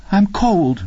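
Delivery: high, thin female voice; acted pitiful, high-pitched complaint; plaintive woman. A narrator's reading. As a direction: complain forlornly of the cold.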